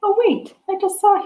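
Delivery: high, bright oh-wait discovery; sudden surprise. Real, not acted. Teacher spotting the resting daddy, surprised. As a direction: catch yourself spotting something new. Noticing something in the picture.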